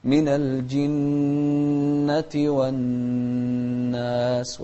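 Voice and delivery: the doubled nun in الجنة and الناس held with two counts of nasal hum; nasal ghunna. Shown as a demonstration. Teacher showing the two-count ghunna on the doubled nun. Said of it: "نغنها بمقدار حركتين".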